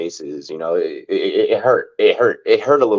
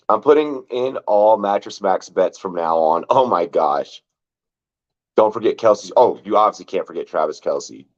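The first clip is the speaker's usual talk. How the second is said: ordinary talk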